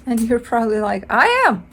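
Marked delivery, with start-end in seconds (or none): 1.1-1.6 high, loud defensive snap; mock yell. Client snapping back at the reader, a mock yell. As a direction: shoot back the words, pretending to be offended. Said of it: "Don't yell at me".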